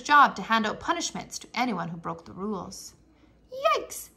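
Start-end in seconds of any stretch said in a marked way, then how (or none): 3.5-4.1 surprised. Acted. Exclamation in read-aloud.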